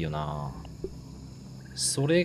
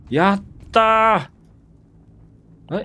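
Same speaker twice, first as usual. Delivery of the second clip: drawn-out cheer; 6 semitones higher, 4 dB louder, much slower than usual. Real, not acted. Bug finally returns, cheering.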